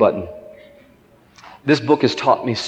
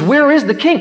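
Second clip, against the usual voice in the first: imitating an excited boy, much higher; eager question; 12 semitones higher, 4 dB louder than usual. Boy asking pastor about the king, excited. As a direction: ask it with high, eager wonder.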